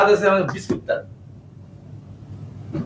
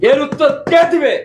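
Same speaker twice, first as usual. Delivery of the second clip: high, loud demand; rhetorical outcry; 10 semitones higher, 3 dB louder than usual. Acted. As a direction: fling the question at the crowd. Rally speaker demands an answer.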